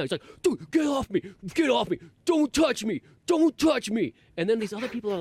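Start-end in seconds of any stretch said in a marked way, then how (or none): 0.4-4.1 high-pitched imitated freak-out shout; mock a hippie losing it, pitched way up. Hippie yelling at a man in the crowd, freaking out. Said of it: "this hippie guy was freaking out".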